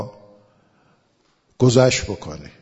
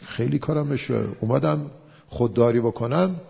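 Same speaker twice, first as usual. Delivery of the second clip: ordinary talk, nothing marked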